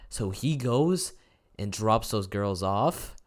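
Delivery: normal talking voice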